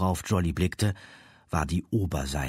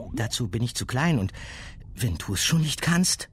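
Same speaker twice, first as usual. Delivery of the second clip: put-on higher young girl voice; 7 semitones higher than usual. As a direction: answer as a girl. Girl answers.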